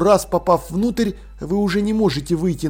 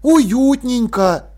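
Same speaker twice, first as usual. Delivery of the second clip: long, lilting high drawl; 6 semitones higher, 4 dB louder, much slower than usual. A narrator's reading. Jern sizes up the little room, drawling. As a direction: draw the word out slowly. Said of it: "протянул он".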